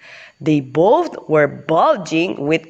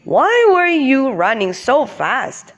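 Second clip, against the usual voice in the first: bumblebee voice; 8 semitones higher than usual. Acted. Bee character voice.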